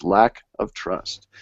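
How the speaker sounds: "lack of trust" slowed right down, each word weighted; emphasized phrase. Shown as a demonstration. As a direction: lean hard and slow on "lack of trust". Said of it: "I'm emphasizing those words".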